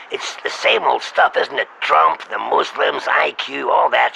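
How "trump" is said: ordinary talk, nothing marked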